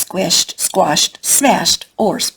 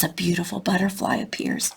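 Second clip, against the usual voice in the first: hushed whisper; 6 dB quieter than usual. A narrator's reading. Magical arrival hushed.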